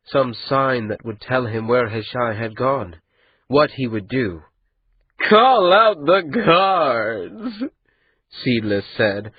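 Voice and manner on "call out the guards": higher, slow, mocking, barely holding a laugh; laughter in his voice. The andat mocking Maati, laughter in his voice. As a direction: laugh at the boy as you say it. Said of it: "laughter in his voice".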